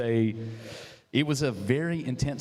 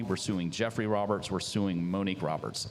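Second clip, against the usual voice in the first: ordinary delivery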